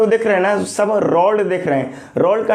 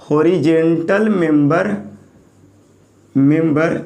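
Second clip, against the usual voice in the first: each word spaced out for notes; teacher dictating slowly, word by word; slow dictation; slower than usual. Shown as a demonstration. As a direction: spell it out slowly for the class to write. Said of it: "horizontal member को बोलेंगे flange".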